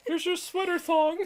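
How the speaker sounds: high, loud falsetto breaking into laughter; Yarn Maiden. Pretend. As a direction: offer it as the silly knitting lady, laughing. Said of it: "knits to metal".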